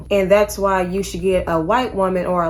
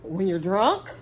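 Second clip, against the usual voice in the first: mocking quote; 4 dB quieter, slower than usual. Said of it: "talking about some".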